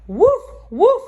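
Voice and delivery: high, loud mock bark; barking like a dog. Cat faking a dog bark at the birds, loud. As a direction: bark it out to scare them. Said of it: "he cried".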